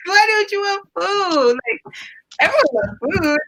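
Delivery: laughing, high-pitched and slowed, in disbelief; incredulous disbelief. Retold or imitated. Retelling her reply to a man refusing food, amused disbelief.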